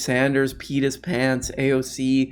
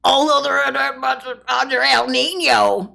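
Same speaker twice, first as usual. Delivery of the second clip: high, loud, shouting Farley imitation; Chris Farley impression; 13 semitones higher, 5 dB louder than usual. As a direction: yell it like a manic SNL Farley. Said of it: "Chris Farley energy".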